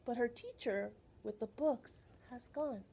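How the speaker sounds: ordinary talk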